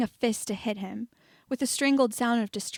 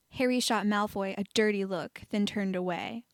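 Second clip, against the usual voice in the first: plain reading voice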